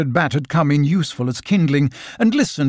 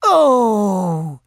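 high, long contented "oh"; small creature's dreamy, happy sigh; 6 semitones higher, 4 dB louder than usual. A narrator's reading. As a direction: sigh it out blissfully, falling from high. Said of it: "sighed Piglet happily".

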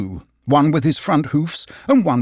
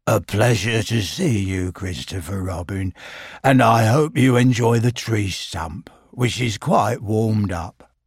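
gloomy Eeyore; 3 semitones lower than usual. Acted. Eeyore voiced in story.

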